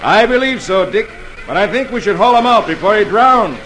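reply with a sly twinkle; twinkling amusement. Acted. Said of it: "with a twinkle in his eye".

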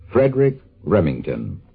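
ordinary talk, nothing marked

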